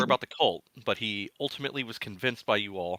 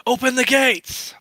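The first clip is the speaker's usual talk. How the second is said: calling out; 10 dB louder, slower than usual. Acted. Voice at the gate of Greenest shouting to be let in. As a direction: shout high and loud, drawn out. Familiar voice calls at gates.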